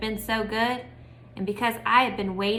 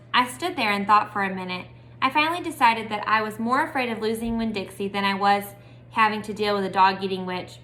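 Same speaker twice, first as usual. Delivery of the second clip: usual speaking voice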